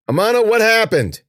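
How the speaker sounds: loud, high call across the room; alarmed shout. Acted. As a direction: shout her name in alarm. Hears a pained yelp.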